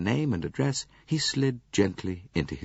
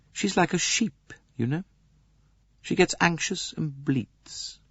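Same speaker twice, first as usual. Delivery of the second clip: put-on young lady's voice; 10 semitones higher than usual. Lady describes her friend.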